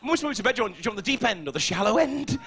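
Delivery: mum's voice. Retold or imitated. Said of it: "mum at the pool".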